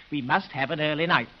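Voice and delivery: ordinary talk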